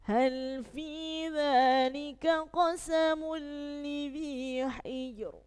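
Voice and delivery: slow chanted recitation of Al-Fajr; Quran recitation. Shown as a demonstration. Teacher reciting Al-Fajr verses to the class, slow chant. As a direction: recite slowly, ro heavy. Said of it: "surat Al-Fajr".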